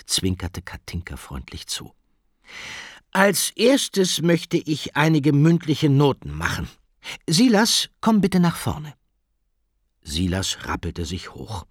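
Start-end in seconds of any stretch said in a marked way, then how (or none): 3.1-8.9 high, loud classroom announcement; teacher voice. Acted. Teacher summons Silas for oral marks, carrying teacher voice. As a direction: call a pupil up in a carrying voice. Teacher calls pupil to the front.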